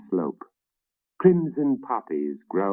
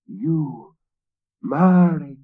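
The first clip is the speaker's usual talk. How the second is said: horrified gasp; 9 semitones higher, 5 dB louder, slower than usual. A narrator's reading. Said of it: "Willem gasped".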